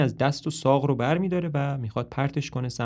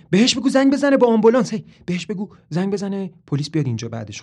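higher, louder and fast; excitedly; 7 semitones higher, 7 dB louder, faster than usual. Acted. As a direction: blurt it out all worked up. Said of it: "مریلین با آب و تاب میگه".

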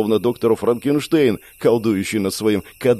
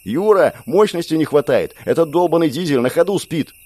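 Andrey shouts to Yura about the weak engine, yelling; 6 semitones higher than usual. A narrator's reading. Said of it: "раздался вопль Андрея".

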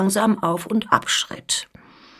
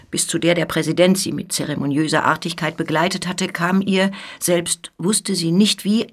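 usual speaking voice

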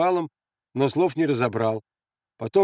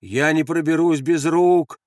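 slow, loud, raised call; shouted protest; 4 semitones higher, 5 dB louder, slower than usual. Acted. Andres stuck at the barbed wire, shouting. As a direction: call it up, drawing out the words. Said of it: "крикнул он".

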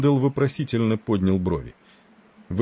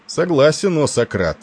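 higher, louder mock-solemn assent; grandly voiced, emphatic agreement; self-important; 8 semitones higher, 6 dB louder than usual. A narrator's reading. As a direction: agree with playful pomposity. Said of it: "важно кивнул Тиндал".

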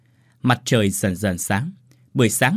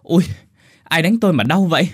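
pained cry; 7 semitones higher, 5 dB louder than usual. A narrator's reading. Tâm in pain after slaps.

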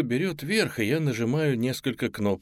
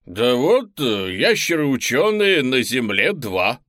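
slow, loud, calm dinosaur voice; unbothered reptile explaining; unruffled raptor; 3 semitones higher, 7 dB louder, slower than usual. Acted. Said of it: "невозмутимо отвечает доисторическая рептилия".

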